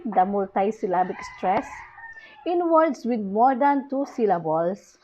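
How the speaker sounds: usual speaking voice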